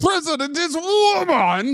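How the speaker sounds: high, slow mock-Russian "President is woman!"; mock Russian accent. Friend jokes about a woman president, mock Russian accent. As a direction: bark it in broken-English Russian style. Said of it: "There's a woman president?".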